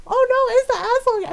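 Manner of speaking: very high, loud pretend panic; mock alarm. Sister teasing brother about the aswang, mock terrified. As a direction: cry out in hammed-up fright. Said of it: "she was so mean to him".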